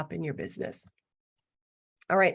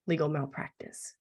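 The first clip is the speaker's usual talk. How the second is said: ordinary talk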